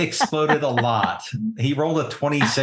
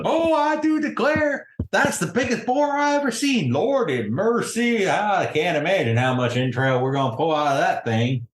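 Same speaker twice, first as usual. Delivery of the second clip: high-pitched, folksy amazed exclamations; exclaiming country lady; 8 semitones higher than usual. Acted. Country lady marvels at the giant boar, astonished high voice. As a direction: start high in astonishment, settle as you go on. Game master plays an astonished townswoman.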